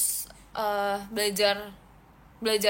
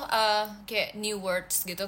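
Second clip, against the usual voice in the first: ordinary talk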